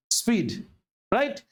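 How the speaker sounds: ordinary talk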